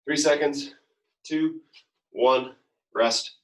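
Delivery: called out countdown. Real, not acted. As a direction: count it down loud to the class. Coach counting down the interval.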